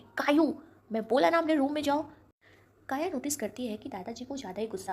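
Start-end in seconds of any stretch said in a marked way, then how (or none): 0.0-2.1 loud, stern command; a grandfather losing his patience; angry grandfather. Acted. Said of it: "दादाजी गुस्से में". (दादाजी का गुस्से भरा ज़ोरदार हुक्म)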